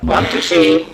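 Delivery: loud Trini slang, laughing; Trinidadian accent. Shown as a demonstration. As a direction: punch it in Trini dialect. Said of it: "you have to say it".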